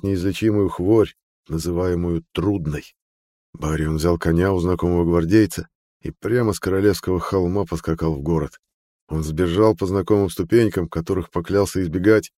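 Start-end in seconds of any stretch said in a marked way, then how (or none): none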